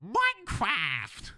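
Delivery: put-on high, drawn-out kid gamer squeal; whiny child voice. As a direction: stretch it out high like an excited child. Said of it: "I hear fucking children".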